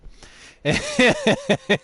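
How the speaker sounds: loud, very high burst of laughter; real laughing fit. Real, not acted. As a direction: cackle at the memory. Laughing at own story.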